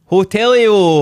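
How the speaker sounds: high, loud mock call of a tourist; Scot abroad. Friend mocking Scots abroad who just add an ending, loud and high. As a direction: belt it out like a hapless holidaymaker. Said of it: "Do that Scottish thing".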